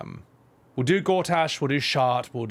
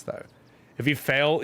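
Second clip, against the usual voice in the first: ordinary talk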